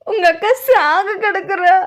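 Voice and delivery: high, loud, slow sobbing through the words. Acted. Servant sobbing to madam that her sister is dying, weeping. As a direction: choke out the bad news in tears. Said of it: "ஏன் இப்படி அழற?".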